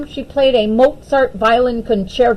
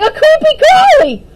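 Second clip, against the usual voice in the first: high loud frightened shriek; shivering fright; 8 dB louder, faster than usual. Acted. Daphne seeing a spider in her bath, high frightened shriek.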